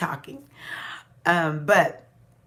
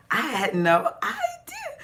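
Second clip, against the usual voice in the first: laugh-cry; 10 semitones higher, slower than usual. Real, not acted. Shocked realisation, laughing crying.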